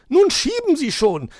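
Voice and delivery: very high, loud impatient command; calling magpie. A narrator's reading. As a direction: shout the order in a high bird voice. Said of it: "rief die Elster". (laut rufende Elster, sehr hoch)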